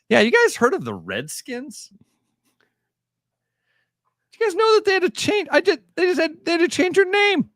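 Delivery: high, fast put-on president voice; squeaky voice, rushing to his pet topic; mock president. An aide asks the president his agenda, high and fast. Acting president answering reporter.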